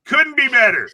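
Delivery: a sarcastic over-bright echo; mocking quote. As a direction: squeak it out in mock cheer. Repeats mocking the phrase.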